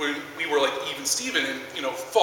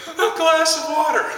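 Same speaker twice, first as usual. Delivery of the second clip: put-on high little-kid voice; 11 semitones higher, 4 dB louder than usual. Uncle imitating the crying four-year-old's request in a high child voice. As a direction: pipe it up like a small child asking.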